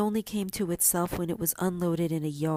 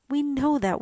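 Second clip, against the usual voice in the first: high, louder eager chirp; 7 semitones higher, 5 dB louder than usual. Acted. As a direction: pipe it up like a sparrow. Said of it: "chirped the sparrows".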